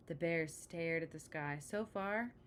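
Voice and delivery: ordinary delivery, nothing marked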